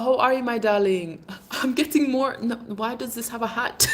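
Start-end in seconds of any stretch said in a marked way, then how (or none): none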